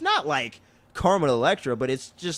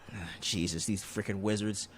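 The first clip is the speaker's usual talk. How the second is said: low, soft retold grumbling; mock weary exasperation; exasperated grumble; 6 semitones lower, 8 dB quieter than usual. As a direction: grumble it to yourself, pitched down. Acting exasperated man grumbling at wizards.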